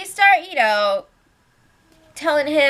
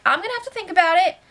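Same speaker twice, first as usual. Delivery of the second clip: light Jason imitation; 7 semitones higher, much faster than usual. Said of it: "So Jason's like".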